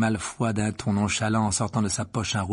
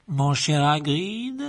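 higher, cold, drawn-out aristocrat; silky menace toward the headmaster; Lucius Malfoy voice; 5 semitones higher, 5 dB louder, much slower than usual. A narrator's reading. Malfoy serves Dumbledore a suspension, coolly superior.

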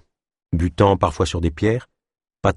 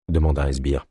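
plain reading voice

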